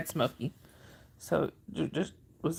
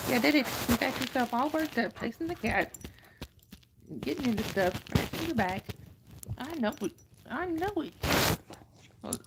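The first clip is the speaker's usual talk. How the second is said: high, soft pet-talk voice; 5 semitones higher, 3 dB quieter than usual. Real, not acted. Owner coos at her wound-up dog in high baby talk.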